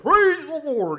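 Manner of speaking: booming, high, drawn-out radio-man holler; hammed-up imitation of a radio praise shout; mocking radio preacher. Preacher mimicking the man on the radio, mocking holler. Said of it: "make fun of him 'cause of his voice".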